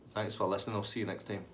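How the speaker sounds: ordinary talk, nothing marked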